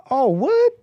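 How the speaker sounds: high, slow mock outrage; mocking the caller. Host mimics the angry caller's outburst, high and slow. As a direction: ham up his indignant squeal. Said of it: "he says".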